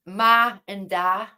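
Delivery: ma and da said slowly, short open ah vowels; Northern Irish ma and da. Shown as a demonstration. Belfast woman teaching Americans how she says ma and da. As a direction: Belfast "ma and da", slow and clear. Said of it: "We say".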